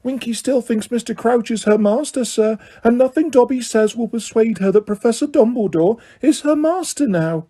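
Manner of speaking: high, small elf voice; Dobby defending Winky, high elf voice; house elf voice. A narrator's reading. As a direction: squeak it like a loyal house elf. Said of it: "Dobby explaining to Harry".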